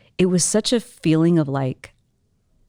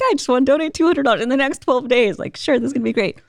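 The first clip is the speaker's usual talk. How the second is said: lightly voiced eager donor, pitched up; imagined donors; 7 semitones higher than usual. Imagining more generous strangers, mock eager donor voice. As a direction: play a giddy imaginary donor. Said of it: "twenty more guys like that that are like".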